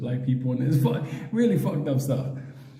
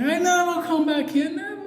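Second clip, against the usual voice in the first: frightened promise. Retold or imitated. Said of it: "He's like".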